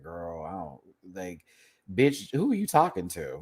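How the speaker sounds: low muttered then snapping; incredulous clapback; imitating Sasha. Sasha to Mistress, low then sharp. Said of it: "Sasha was like".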